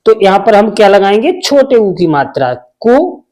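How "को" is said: को said with a short clipped u; short u sound. Shown as a demonstration. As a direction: keep the u in को brief. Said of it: "ऊ का sound तो आया लेकिन बहुत कम".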